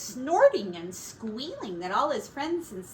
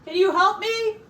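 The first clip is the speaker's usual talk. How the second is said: high, loud call upstairs; 10 semitones higher, 9 dB louder than usual. Acted. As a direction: call to her from another room. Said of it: "called Father Pig from upstairs".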